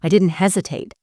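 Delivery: ordinary delivery, nothing marked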